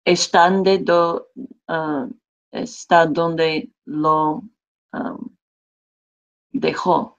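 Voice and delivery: "Está donde lo dejó" drawn out slowly, word by word; despacio, palabra por palabra; slow careful Spanish. Shown as a demonstration. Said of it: "But I'd say".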